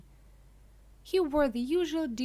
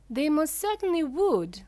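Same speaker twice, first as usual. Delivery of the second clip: high, loud indignant cry; 8 semitones higher, 9 dB louder than usual. A narrator's reading. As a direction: cry it out, offended, higher and louder. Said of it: "cried Mister Gloop indignantly".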